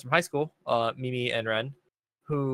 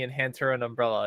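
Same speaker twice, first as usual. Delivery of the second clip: ordinary delivery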